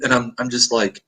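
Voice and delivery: ordinary talk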